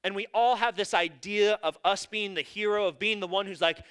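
ordinary talk

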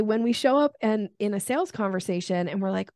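ordinary delivery

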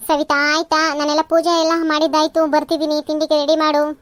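loud household summons; calling out. Acted. Mother-in-law calling daughter-in-law to ready breakfast, loudly calling out. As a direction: call out loudly with an order.

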